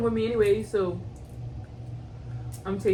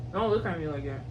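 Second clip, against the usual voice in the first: ordinary delivery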